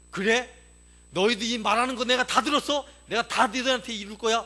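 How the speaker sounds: loud, high, indignant challenge; angry divine retort. Retold or imitated. God hears the people's complaints, angry retort. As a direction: thunder back as an offended God.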